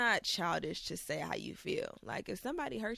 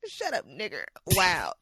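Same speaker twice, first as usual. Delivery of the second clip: ordinary delivery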